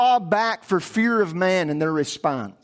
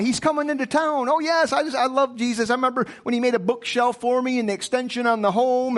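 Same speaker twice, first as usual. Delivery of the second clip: higher-pitched, gushing hometown admirer; townsfolk's excitement; 5 semitones higher than usual. Acted. Preacher plays townsfolk welcoming the carpenter rabbi, excited. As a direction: gush fondly about the local carpenter, pitched up. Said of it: "the excitement turns to rage".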